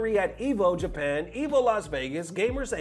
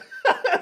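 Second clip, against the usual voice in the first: high, sudden laugh at the absurd comment; burst of laughter; 14 semitones higher, 4 dB louder than usual. Real, not acted. Streamer laughs at a comment with a high, sudden burst. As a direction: let out a sharp, high laugh.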